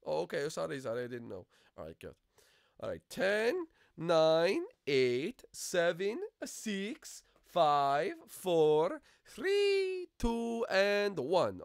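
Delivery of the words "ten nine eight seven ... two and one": loud, high, drawn-out counting; high sing-out countdown; seeker's countdown. Acted. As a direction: call each number out for all to hear. Counts down for hide and seek.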